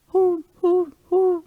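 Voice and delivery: high, hollow owl hooting; playful high owl call; owl hoots. Pretend. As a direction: hoot like a little owl. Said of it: "She hoots in the dark".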